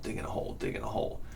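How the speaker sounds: breathy whispered rhythm; unvoiced trailing repetition; whispered repeat. Real, not acted. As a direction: whisper it like you're digging. Mimed digging, whispered.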